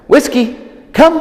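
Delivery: high, loud call across the yard; high dog-recall call. Shown as a demonstration. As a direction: call her name bright and high. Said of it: "when I actually call her to come".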